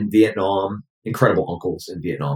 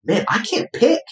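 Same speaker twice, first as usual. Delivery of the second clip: overwhelmed; 13 semitones higher than usual. Retold or imitated. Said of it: "I'm like".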